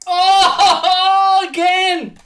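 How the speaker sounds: loud high cry of despair; anguished shout. Real, not acted. Gamer despairing as another goal goes in, shouting. Conceded again.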